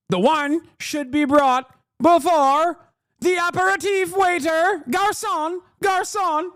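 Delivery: a hint of a stuck-up diner calling the waiter; snooty diner. Restaurant customer summoning a waiter, mock snooty posh voice. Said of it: "some dickhead's like".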